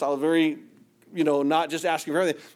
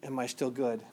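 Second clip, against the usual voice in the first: hushed, much softer question; whispered; 5 semitones lower, 9 dB quieter than usual. Retold or imitated. The Spirit speaking softly to the preacher, hushed. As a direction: ask it gently like a still small voice. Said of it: "the Spirit of God whispered to me and said".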